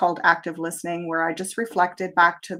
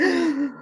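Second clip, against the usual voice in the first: high mock gasp of fear; quick fearful intake of breath; 9 semitones higher than usual. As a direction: gasp like a frightened sheep. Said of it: "they're like".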